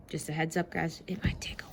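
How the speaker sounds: quick whisper; hushed quick aside. Real, not acted. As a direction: let them in on a joke.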